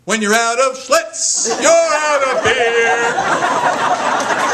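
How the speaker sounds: slow, loud, drawn-out ad slogan; boy drags out the slogan; slow boy's line. Retold or imitated. Child booming a beer slogan in the spotlight, loud and slow. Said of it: "he goes".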